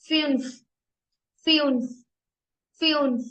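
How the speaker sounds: फ्यून्फ with a rounded ü, "fyunf"; teacher drills फ्यून्फ; German fünf. Shown as a demonstration. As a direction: stress the ü in फ्यून्फ. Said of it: "ये यू की कैसे sound आ रही है".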